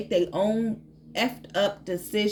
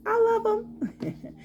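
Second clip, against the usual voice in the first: higher, slow gushing squeal; squealing delight; much slower than usual. Real, not acted. Tarot reader gushes over her new cards, high and slow. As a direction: gush over them in a high, drawn-out voice.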